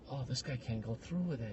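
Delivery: very soft, low sneering aside; a smug passenger sneering quietly; mock mutter. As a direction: mutter it under your breath with glee. Voicing smug onlookers.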